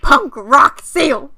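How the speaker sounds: slow loud proclamation. Real, not acted. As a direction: announce it like a band name. Joking about a punk seal.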